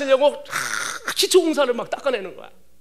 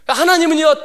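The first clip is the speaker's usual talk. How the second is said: ordinary delivery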